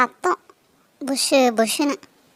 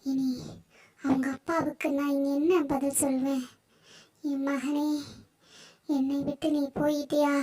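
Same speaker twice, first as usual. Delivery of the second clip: grieving snake goddess; 7 dB quieter than usual. Acted. Snake goddess grieves her killed son, soft and mournful. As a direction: mourn the son quietly, vowing revenge.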